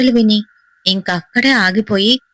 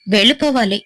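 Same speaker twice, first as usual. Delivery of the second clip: usual speaking voice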